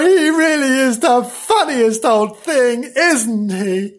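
put-on high, gushing aside to children; gushing storyteller. Storyteller to children about Flange, gushing amusement. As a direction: exclaim it in a high sing-out voice. Storyteller cooing over the character.

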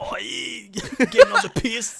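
squealing high laugh in a Bogan voice. Acted. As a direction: cackle through a mock Aussie line. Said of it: "pretending to be these characters".

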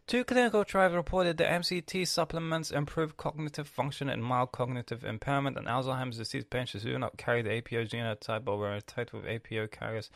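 reading a search result aloud. Acted. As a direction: read the snippet off the screen.

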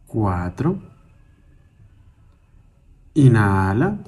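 slow single-word cues, each vowel drawn out; calm, measured breath counting with long silences; guided breathing meditation. Acted. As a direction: pace someone's breathing, stretching each cue unhurriedly.

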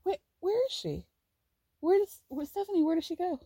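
mock-bragging to the guys, then looking around for Stephanie; imitating giddy Brian. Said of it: "he's, like, going around".

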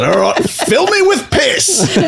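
laughing. Retold or imitated. Laughing through a retold quote.

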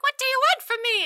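high hammed-up fright; mock alarm. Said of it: "she's like".

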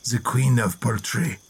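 put-on a light French accent on the quote; mock French gourmet voice, lightly done. Host quoting a French writer in a light French accent. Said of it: "I'm going to do an accent here".

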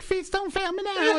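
high, laughing put-on voice; panicked cartoon voice. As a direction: squeal it like a fleeing cartoon. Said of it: "it's like".

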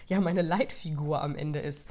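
normal talking voice

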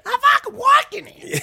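very high, loud rock-singer scream; botched accent blown up into a screech. Pretend. Joking attempt at a New York line, hammed-up screech. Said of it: "that was an A C D C singer".

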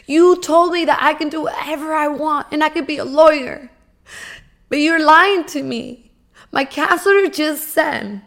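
hurt and furious, demand the truth; yelling at dad. Retold or imitated. Daughter yelling at her father, loud and accusing. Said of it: "I started yelling at my dad".

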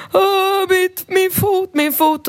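put-on high wailing little boy, stretched slowly; crying child. Rambo wailing to the teacher about his scraped foot, high sobbing voice. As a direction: whimper it like a hurt toddler. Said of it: "han storgråter och säger så här".

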